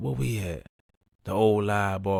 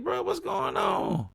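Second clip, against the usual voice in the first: much higher, slow concerned question; high worried asking; slower than usual. Retold or imitated. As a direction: ask your crying friend what happened, pitched up. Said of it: "we like".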